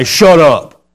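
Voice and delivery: loud, forceful mock "shut up"; shouted shut-up. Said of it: "It's not to say".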